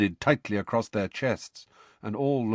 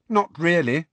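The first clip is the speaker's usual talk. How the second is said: indifferent; 8 semitones higher, 4 dB louder than usual. A narrator's reading. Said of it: "said Hermione indifferently".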